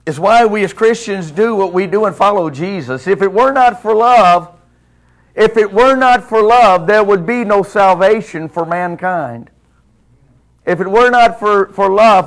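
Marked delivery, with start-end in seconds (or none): 3.1-12.2 loud, rising preacher's cry; preaching at full force. Real, not acted. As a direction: drive it home from the pulpit.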